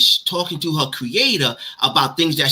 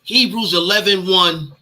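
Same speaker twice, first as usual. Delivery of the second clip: ordinary talk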